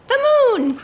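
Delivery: loud, high, thrilled announcement; excited exclamation. Acted. Teacher reading to children, excitedly revealing the moon. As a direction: gasp it out like a big reveal. Story climax read to kids.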